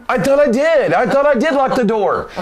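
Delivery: high-pitched defensive whine, acted out; flustered protest. Mark answering the boss through the door, flustered protest. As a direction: protest your innocence, pitched well up.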